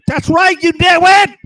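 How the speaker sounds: high, loud mocking yell; shouting back. Man answers his watcher, shouting back. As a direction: yell the insult at the top of your range. Yelling back in retold quarrel.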